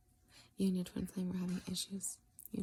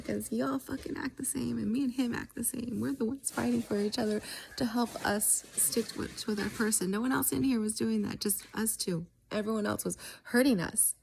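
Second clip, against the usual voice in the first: higher, louder retold tirade, fed up; reenacting a furious rant at the others; angry outburst; 5 semitones higher, 5 dB louder than usual. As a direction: lay into them, done with it all. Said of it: "And I was like".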